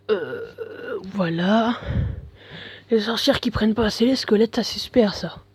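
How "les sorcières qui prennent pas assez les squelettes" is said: usual speaking voice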